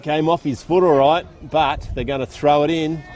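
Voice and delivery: brisk live football call from the box; play-by-play commentary. Acted. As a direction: call the game as it unfolds, rising with the play.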